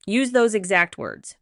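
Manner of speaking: plain reading voice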